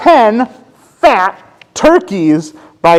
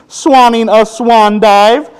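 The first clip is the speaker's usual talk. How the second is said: ordinary delivery, nothing marked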